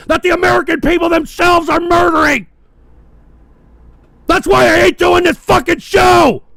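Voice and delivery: screaming his fury at the audience; furious ranting shout. Real, not acted. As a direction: yell it, shaking with rage. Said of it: "I'm so fucking pissed off".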